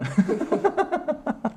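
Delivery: a high falling laugh at his own pun. Real, not acted. Host laughing at his own made-up word, high falling laugh. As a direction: let a high laugh tumble down.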